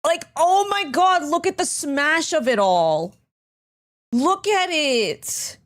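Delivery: slow, high, drawn-out awe; awed. Real, not acted. Streamer gushing at the burger on screen, awed and slow. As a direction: marvel at the burger slowly.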